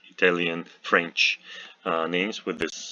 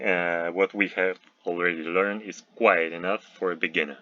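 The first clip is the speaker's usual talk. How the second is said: ordinary delivery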